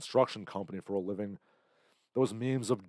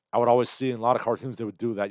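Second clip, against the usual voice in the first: normal talking voice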